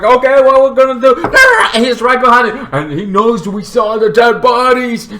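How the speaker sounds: loud, high, frantic chatter; panicked. Acted. Siblings chased by killer in car, panicked yelling. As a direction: gabble it at full alarm.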